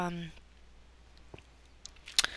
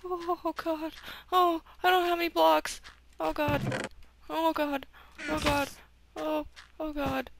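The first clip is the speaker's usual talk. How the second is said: higher, louder frightened moans; terrified horror victim; 9 semitones higher, 4 dB louder than usual. Real, not acted. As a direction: moan in fear, losing control. Falling into the void.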